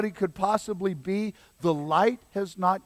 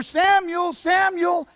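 high, loud call; 13 semitones higher, 6 dB louder, slower than usual. Acted. God calls the boy a third time, loud high calling.

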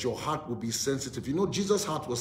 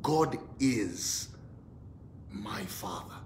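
fading to soft and low; quiet prayerful fade; soft declaration; 4 semitones lower, 7 dB quieter, slower than usual. Real, not acted. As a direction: let it sink to near silence.

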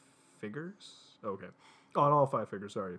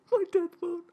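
very high, louder mock squeal; squealed exclamation; 5 dB louder than usual. Reader retaking the hero's exclamation, absurdly high squeal.

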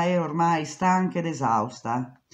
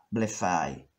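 usual speaking voice

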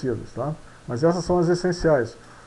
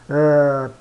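ordinary talk, nothing marked

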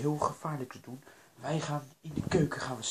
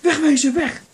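high, very loud alarm; frantic high-pitched warning shout; panicked shouting; 12 semitones higher, 14 dB louder than usual. Acted. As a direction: yell for everyone to get away. Panic at hearing the ghost coming.

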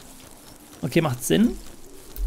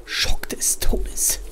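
slow hushed whisper; 8 dB quieter, slower than usual. Real, not acted. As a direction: breathe the words out slowly. Spooky moment in horror game.

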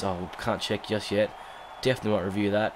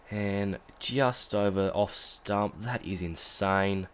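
slow, hushed amazement; in awe; awed slow; much slower than usual. Real, not acted. Awed by replay.

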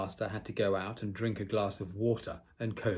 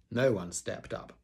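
ordinary delivery, nothing marked

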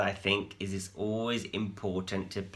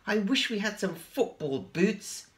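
sigh; 11 semitones higher, 4 dB louder than usual. A narrator's reading. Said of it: "sighed Spinderella".

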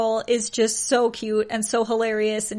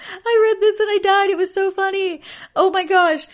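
laughing; 9 semitones higher, 4 dB louder than usual. Real, not acted. Laughing while retelling funny story.

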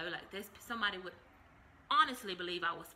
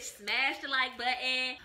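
slow, louder, each word punched; slow catchphrase; 4 semitones higher, 5 dB louder, slower than usual. Shown as a demonstration. Vlogger's new sign-off to viewers, slow and emphatic. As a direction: deliver it like a signature slogan. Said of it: "my new sign language".

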